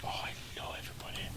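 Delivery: breathy whispered aside. Acted. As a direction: whisper it under your breath.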